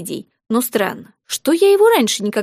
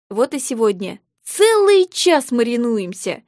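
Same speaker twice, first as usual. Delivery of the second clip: ordinary delivery, nothing marked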